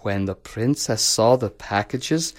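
ordinary delivery

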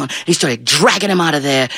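usual speaking voice

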